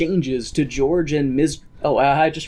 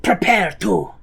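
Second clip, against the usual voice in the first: Inigo Montoya voice. Retold or imitated. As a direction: declaim it like a vengeful duelist. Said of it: "In the Inigo Montoya voice".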